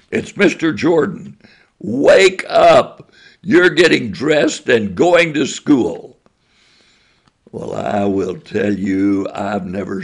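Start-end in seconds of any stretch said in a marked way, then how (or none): 0.1-6.2 loud, higher, commanding. Retold or imitated. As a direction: order him out of bed, loud and firm. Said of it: "I said in a loud, commanding voice".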